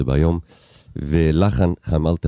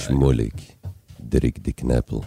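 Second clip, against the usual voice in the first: lower, softer Yiddish-accented run-through of דריק די קנפל; a soft Yiddish-accented try for the host; quieter Yiddish ad voice; 5 semitones lower than usual. Shown as a demonstration. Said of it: "תעשה את זה רגע".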